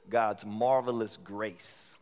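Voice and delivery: drawn-out, savoring the phrase; slow and deliberate on marvelous grace; slow church words. Shown as a demonstration. Preacher to congregation, slow reverent phrase. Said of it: "That's a church word".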